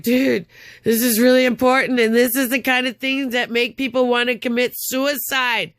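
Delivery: high-pitched, insistent retold plea; urgent protest. Upset caller warning the newsroom man, urgent protest.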